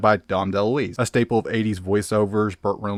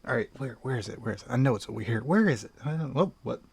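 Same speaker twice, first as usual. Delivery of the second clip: much softer, half under his breath; muttering to himself; 9 dB quieter, faster than usual. Real, not acted. Digging through the shelf for the tape, soft muttering. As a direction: mumble as you rummage the shelf.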